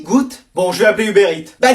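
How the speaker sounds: normal talking voice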